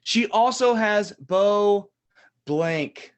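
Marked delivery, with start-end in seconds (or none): none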